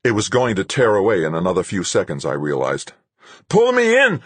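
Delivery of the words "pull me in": desperate shout. Acted. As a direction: shout it out in panic. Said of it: "Rodriguez shouted again".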